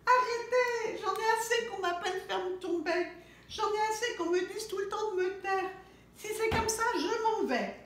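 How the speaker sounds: high, loud tearful protest; crying chick. A narrator's reading. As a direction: plead through tears. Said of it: "Il se met à pleurer".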